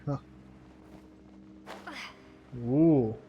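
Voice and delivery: ordinary talk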